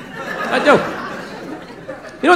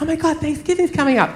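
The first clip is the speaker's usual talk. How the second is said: lightly imitated excitement, softer; excited girlfriend; 2 semitones higher, 5 dB quieter than usual. Girlfriend telling him the holiday is near, mildly excited. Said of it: "so she's all excited".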